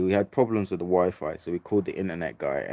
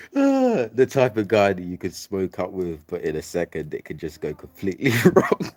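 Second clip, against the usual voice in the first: laughing while telling; 3 semitones higher, 6 dB louder than usual. Real, not acted. Laughing about dangerous dealer.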